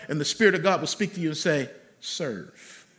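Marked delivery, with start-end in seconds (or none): 2.0-2.9 low, much softer serve; low soft command. Acted. Preacher voices the Spirit's quiet call. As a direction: breathe it out quietly.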